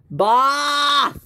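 high-pitched shout for the boss; calling out. Acted. Worker yelling for the boss, calling out. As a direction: yell it across the store. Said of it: "I just yell at my, um, worker".

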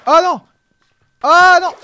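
loud high dismayed cry; alarmed cry. Real, not acted. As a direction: shout in alarm at being hit. Getting hit in game. (cri d'alarme)

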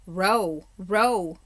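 loud bellow at the oarsmen. Acted. King urging his rowers through the dark, bellowed. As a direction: bellow the order to row. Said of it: "bellowed Caspian".

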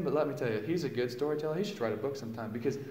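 ordinary talk

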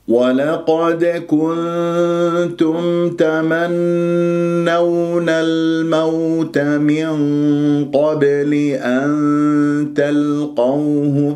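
very slow, sustained tajweed chant; slow, measured Quranic tarteel; Quran recitation. Shown as a demonstration. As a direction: recite the verse with long held nasals and vowels. Recites the verse before teaching it.

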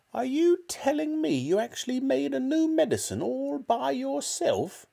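incredulous, very high granny squawk; shouting. A narrator's reading. Grandma questioning George from the roof, shouting. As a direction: question him in a piercing old-woman voice. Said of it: "she shouted".

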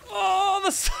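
very high shout breaking into laughter; astonished shout. Real, not acted. Gamer seeing the science victory arrive, astonished laughing shout. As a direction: yell in disbelief, then collapse laughing.

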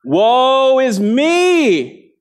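very slow, high, loud "Woe is me"; a prophet's anguished cry, Woe is me. Retold or imitated. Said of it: "and he cries out".

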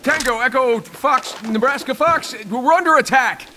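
high-pitched radio alarm; urgent distress call. Acted. Soldier calling in code that they're under attack, urgent alarm. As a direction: send an urgent mayday, pitch jumping up.

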